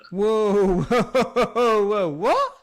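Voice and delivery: loud, high, laughing whoa; laughing disbelief. Real, not acted. Reacting to a mate's wrong bread word, loud laughing disbelief. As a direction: burst out in amused shock.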